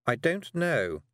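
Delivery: a busy, slightly nervous witch; Miss Tick. A narrator's reading. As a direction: witch talking a girl into a walk. Miss Tick's voice.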